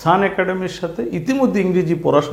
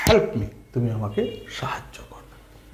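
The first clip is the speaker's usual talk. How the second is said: whisper; 7 semitones lower, 9 dB quieter than usual. Shown as a demonstration. Teacher gives sentence and meaning.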